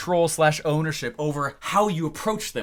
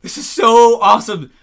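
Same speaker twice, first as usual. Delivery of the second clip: loud, high thrilled gush; excited client; 7 semitones higher, 9 dB louder than usual. Retold or imitated. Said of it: "everyone's like".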